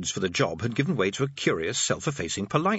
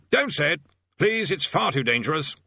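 higher-pitched, urgent pleading; alarmed plea; 6 semitones higher than usual. Acted. Bursar pleads against the dangerous rite, alarmed. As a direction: beg them to stop.

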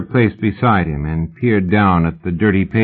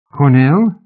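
Cornell asked high and loud, rising; surprised question; 6 semitones higher, 5 dB louder than usual. A narrator's reading. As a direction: ask Cornell in sharp disbelief. Stunned disbelief at the name.

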